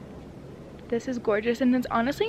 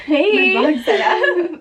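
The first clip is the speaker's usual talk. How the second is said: excited; 6 semitones higher, 6 dB louder, slower than usual. Real, not acted. Greeting friend arriving.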